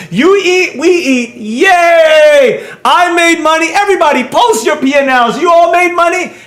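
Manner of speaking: high-pitched, over-the-top cheering; hammed-up gleeful bragging, pitched high; mock hype. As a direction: parody a hyped-up trading group celebrating. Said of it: "Discord channels that be like".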